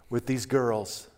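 normal talking voice

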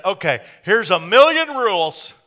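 imagined killjoy God listing rules, hammed up; mock killjoy God. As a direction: bark rules like a no-fun Lord. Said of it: "you'd think that God would have said".

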